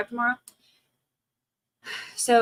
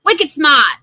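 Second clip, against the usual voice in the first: put-on playful Boston accent, "smart" as "smaht"; hammed-up Mass accent, louder on the retry; Massachusetts accent; 4 semitones higher, 6 dB louder, slower than usual. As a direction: drop the R like a mock Bostonian. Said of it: "with the Mass accent".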